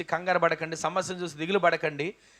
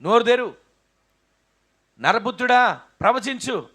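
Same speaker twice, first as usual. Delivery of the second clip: loud, higher divine command; God commanding; 5 semitones higher, 9 dB louder than usual. Acted. Preacher voicing God ordering Ezekiel to prophesy. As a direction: call out like God summoning the prophet. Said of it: "దేవుడు ఏం చెప్పాడు?".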